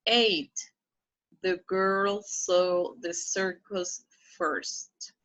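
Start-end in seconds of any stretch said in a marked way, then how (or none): 1.4-5.1 slow model reading. Shown as a demonstration. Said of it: "slow model reading".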